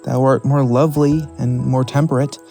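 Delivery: ordinary delivery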